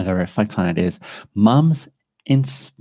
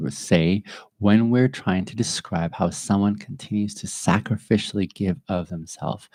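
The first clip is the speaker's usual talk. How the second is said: ordinary talk, nothing marked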